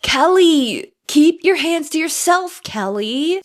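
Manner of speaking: teasing scold to a TV character; mock scolding. Real, not acted. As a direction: wag a finger at her through a laugh. Scolding a character while recapping.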